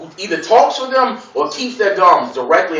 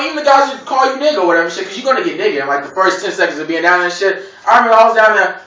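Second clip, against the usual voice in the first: normal talking voice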